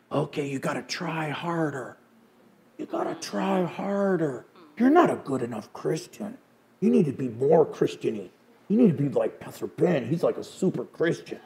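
a sly demon murmuring in the ear; demon whisper. Retold or imitated. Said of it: "we're gonna whisper into everybody's ear".

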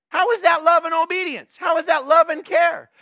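loud, high, indignant outburst; indignant shout. Real, not acted. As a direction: demand it of the congregation, full voice. Preacher confronting congregation.

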